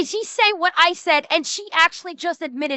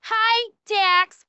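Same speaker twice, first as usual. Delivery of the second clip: ordinary talk, nothing marked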